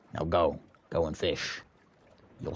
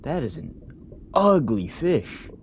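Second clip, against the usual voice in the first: disgusted; 8 semitones higher, 4 dB louder, slower than usual. Real, not acted. Player reacts to a creepy fish.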